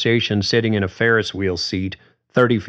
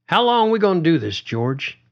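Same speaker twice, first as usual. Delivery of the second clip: quick, higher Carly voice; Carly asking; 10 semitones higher than usual. A narrator's reading. Carly pressing George on the ferris wheel, quick and higher. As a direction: rush the question up high, then soften on the name. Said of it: "Carly was suddenly serious".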